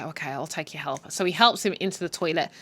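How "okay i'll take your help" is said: grudging mutter. Retold or imitated. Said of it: "he's like".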